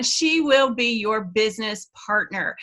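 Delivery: usual speaking voice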